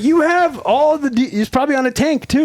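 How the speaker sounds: high, incredulous retort; exasperated disbelief. Retold or imitated. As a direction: protest it like it's absurd.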